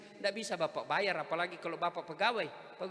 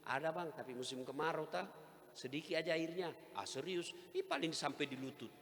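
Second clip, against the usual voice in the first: quiet wife voice; 4 semitones lower, 9 dB quieter than usual. Retold or imitated. Wife answers husband at well.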